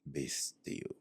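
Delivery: soft, low, whispered command; God answering in a whisper. Retold or imitated. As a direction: hush him, calm and final. Voicing the Lord's answer.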